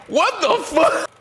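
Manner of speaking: high, slow shouted what the fuck; shouted disbelief. Real, not acted. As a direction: yell it out, stunned. Reaction to absurd news.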